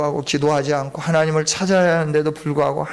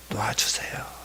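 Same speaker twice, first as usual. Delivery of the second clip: breathy whisper, much lower and softer; whispered plea; 9 semitones lower, 10 dB quieter than usual. Acted. Preacher acting a prayer to God, whispered plea. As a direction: let the last word of the prayer fall to a whisper. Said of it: "기도하는 겁니다".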